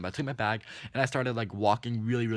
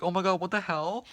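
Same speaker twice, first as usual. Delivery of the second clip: high, squealed disbelief; mock-horrified, rising high at the end; appalled; 8 semitones higher than usual. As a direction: act out grossed-out shock. Retold shock at the driver.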